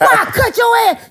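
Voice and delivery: high shrieking mock threat; mock shout. Dirty South threatening Teddy with a knife, in a high shrieking mock threat. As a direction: scream it at him in a high voice.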